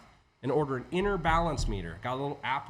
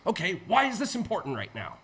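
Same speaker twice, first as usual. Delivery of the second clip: exasperated; 8 semitones higher, 4 dB louder than usual. Retold or imitated. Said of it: "eventually you're like".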